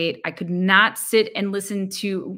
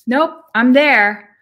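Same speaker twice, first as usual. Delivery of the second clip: firm inner reply; 6 semitones higher, 10 dB louder, much slower than usual. Retold or imitated. Quoting her own inner reply.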